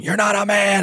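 high mock scream in labour; mother in labour yelling at husband, high mock scream; mock birth scream.

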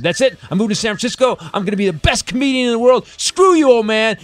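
shouting defiance at his father, rising high; loud, high teenage outburst; defiant yell. Retold or imitated. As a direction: yell it at the old man, fed up. Teen yelling at dad, moving out.

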